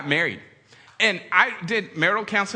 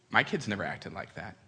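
a hint of a prim mother-in-law, lower and softer; 10 semitones lower, 8 dB quieter than usual. Comedian voicing his mother-in-law at Christmas, low and soft. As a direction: mimic the mother-in-law lightly, low and hushed.

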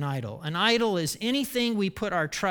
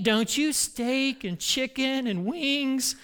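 ordinary talk, nothing marked

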